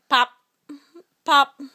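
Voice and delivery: sharp loud popping sound; cork popping. Shown as a demonstration. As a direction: pop like a cork. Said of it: "He can sound like a cork".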